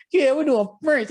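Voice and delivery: high-pitched, lively imitation of the girls; girls at orientation. Retelling what orientation girls said, high mock-eager voices. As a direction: mimic an eager group of girls, pitched well up. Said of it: "all the girls in my orientation was like".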